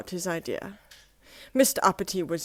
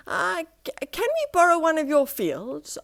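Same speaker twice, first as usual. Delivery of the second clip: hesitant ask; 9 semitones higher, 3 dB louder than usual. Acted.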